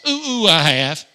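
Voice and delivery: loud mock-eager volunteer; eager hand-raiser. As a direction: hoot it out as if begging to be picked.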